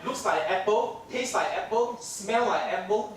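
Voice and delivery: ordinary delivery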